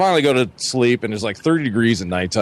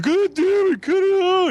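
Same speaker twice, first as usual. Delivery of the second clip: very high, squealed imitation of an irritated friend; hammed-up squeaky anger; mock pissed-off. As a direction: mimic his exasperated outburst in falsetto. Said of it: "He's getting all pissed".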